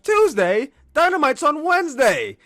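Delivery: high, loud, puzzled objection; baffled at the wrong day; confused surprise. Retold or imitated. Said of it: "Alex Marvez is like".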